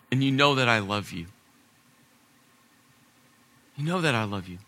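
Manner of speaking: low, soft, tender admission; humble, heartfelt hush; sincere confession. Acted. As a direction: drop down and confess it quietly. Peter's quiet confession after outburst.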